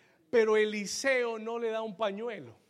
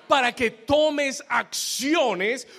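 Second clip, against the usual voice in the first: loud, high, stretched-out exhortation; booming preacher's call to act; full-force preaching; 4 semitones higher, 7 dB louder, much slower than usual. Real, not acted. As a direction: shout it out to the congregation, drawing out the words.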